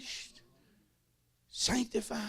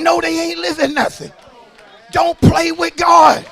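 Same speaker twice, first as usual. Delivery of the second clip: loud, high whooping preach; full-force preaching; 6 semitones higher, 12 dB louder than usual. Real, not acted. Preacher warning the congregation, loud high whoop.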